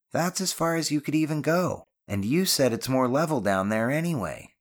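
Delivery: remarking to a friend. A narrator's reading. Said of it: "Ben remarked".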